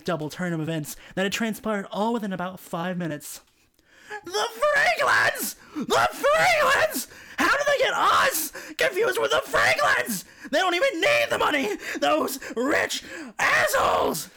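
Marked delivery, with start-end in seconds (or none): 4.2-14.3 outraged shout. A narrator's reading. Family outraged.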